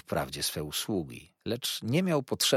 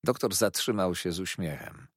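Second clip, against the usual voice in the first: usual speaking voice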